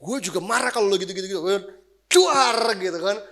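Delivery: hammed-up ranting at his wife; mock angry outburst. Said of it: "kan nunjukin ego dong".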